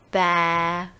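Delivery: long baa held on one note; sheep bleat. Shown as a demonstration. Teacher demonstrating animal sounds, drawn-out sheep baa. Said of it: "Animal sounds".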